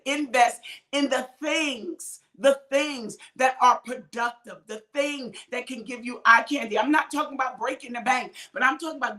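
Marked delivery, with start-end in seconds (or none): 0.0-4.6 slow, drawn-out preacher's emphasis on "invest in the things"; call and response. Shown as a demonstration. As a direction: stretch out "invest in the things" so the church can say it back. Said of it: "somebody say".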